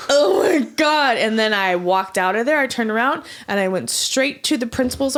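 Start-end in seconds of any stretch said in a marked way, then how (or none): 0.1-1.2 shocked gasp. Retold or imitated. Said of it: "she just looked at me like".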